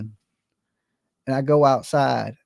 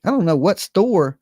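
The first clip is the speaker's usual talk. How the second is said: normal talking voice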